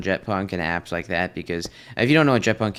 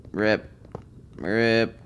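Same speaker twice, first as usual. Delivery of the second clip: usual speaking voice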